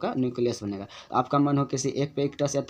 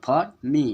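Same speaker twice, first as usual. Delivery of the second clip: "for me" in two beats, "me" held longer than "for"; stress on me; 3 semitones higher, 7 dB louder than usual. Shown as a demonstration. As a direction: stretch "me" after a shorter "for". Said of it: "me थोड़ा देर के लिए पढ़े हैं for से". ("me" को "for" से ज़्यादा देर तक)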